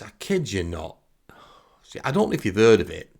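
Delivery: normal talking voice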